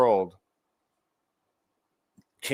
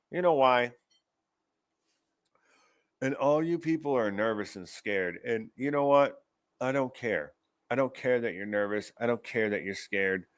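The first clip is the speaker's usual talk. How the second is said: ordinary talk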